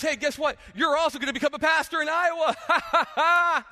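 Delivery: high, slow, laughing voice of God delivering a punchline; God springing a surprise on the speaker, high and laughing; God's voice. Retold or imitated. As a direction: play God teasing him, grinning through it. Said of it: "And then God goes".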